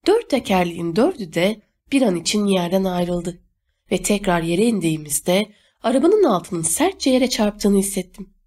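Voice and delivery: ordinary delivery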